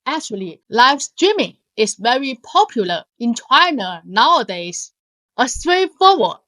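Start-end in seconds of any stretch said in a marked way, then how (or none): none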